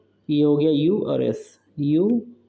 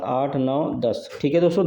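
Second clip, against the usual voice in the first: ordinary delivery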